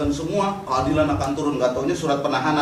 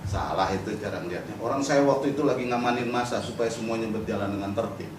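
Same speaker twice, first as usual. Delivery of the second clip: low, softer casual denial; savvy activist; 7 semitones lower, 5 dB quieter than usual. Retold or imitated. Savvy activist denying the accusation to police, low-key and unruffled. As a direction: play the detainee calmly explaining it away. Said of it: "Tapi kalau di aktivis yang jago".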